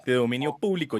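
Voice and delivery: ordinary delivery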